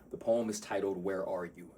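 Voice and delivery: ordinary talk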